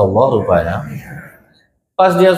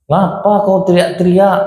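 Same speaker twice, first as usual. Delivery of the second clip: annoyed husband; 5 semitones higher than usual. Retold or imitated. Annoyed husband answers his wife.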